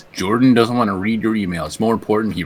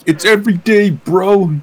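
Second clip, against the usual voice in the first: mock bro catchphrase, pitched high; high-pitched bro impression; 9 semitones higher, 4 dB louder than usual. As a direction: imitate a rapping influencer. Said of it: "he's like".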